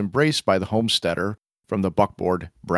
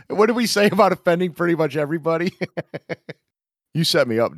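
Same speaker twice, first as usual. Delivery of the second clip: normal talking voice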